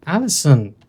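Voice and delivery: loud scolding shout of the name; exasperated call-out. Real, not acted. Player scolding the game's Allison, loud exasperated call. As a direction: call her out, fed up.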